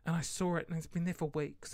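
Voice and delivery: normal talking voice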